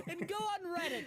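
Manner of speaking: giggling through it; laughing. Real, not acted. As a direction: laugh out the words. Laughing while announcing.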